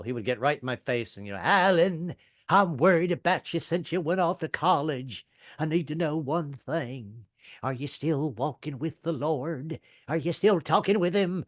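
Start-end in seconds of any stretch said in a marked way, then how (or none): 1.4-11.4 slow, sing-song sermon in a grandpa's voice; preacher grandfather. Acted. Grandfather sermonizing his college grandson at breakfast, drawn-out preacher voice. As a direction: preach it as an imitated Baptist grandfather. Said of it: "personal sermons right at the breakfast table".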